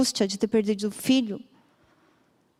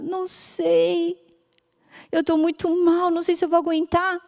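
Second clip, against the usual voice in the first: crying; 7 semitones higher than usual. Retold or imitated. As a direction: speak through tears, barely holding on. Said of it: "começou a chorar, chorar". (mãe chorando para a médica, voz alta e chorosa)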